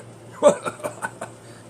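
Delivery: real chuckle at own impression; laughing. Real, not acted. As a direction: crack up at your own silly voice.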